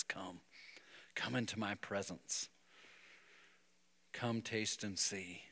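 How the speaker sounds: gentle fatherly invitation; soft invitation. Pretend. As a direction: invite them in tenderly. God inviting, quoted softly.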